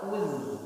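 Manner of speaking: "Uz" with the tongue drawn back, thin; uz pulled back. Shown as a demonstration. Teacher showing the pulled-back uz. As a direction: pull "Uz" back, keep it thin. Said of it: "tarik belakang".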